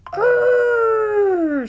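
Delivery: very high, loud long growl; bear growl. A narrator's reading. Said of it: "said the brother bear".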